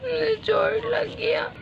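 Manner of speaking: soft, slow, breathy whimper; hushed hurt whimper. Acted. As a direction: sob it quietly. Child hurt, whispered sob.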